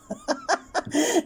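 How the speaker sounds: sudden high squealing laugh; high burst of laughter. Real, not acted. Laughing at the dancing family, a high squealing laugh.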